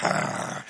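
breathy mock growl; imitated growl. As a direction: growl like a fired-up preacher. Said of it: "he's like".

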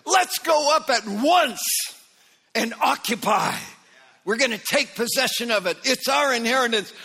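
high, loud and bold rallying call; rally the people to take the land; voice of faith. Retold or imitated. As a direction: Caleb urging Israel forward, bold and loud. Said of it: "there's the voice of faith".

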